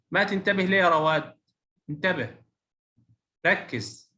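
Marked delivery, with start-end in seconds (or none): none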